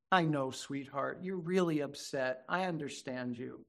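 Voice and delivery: put-on soft, hushed comforting of an upset child; tender reassurance. Therapist soothing a crying child.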